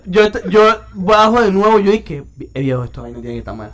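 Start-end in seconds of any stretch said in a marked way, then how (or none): 2.5-3.7 quick, low-volume mutter of disbelief; stunned mutter. Retold or imitated. Telling his friends how he reacted to the fine, in a quick stunned mutter. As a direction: rush it under your breath, half to yourself.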